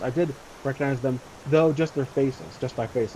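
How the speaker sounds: ordinary delivery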